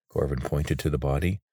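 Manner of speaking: usual speaking voice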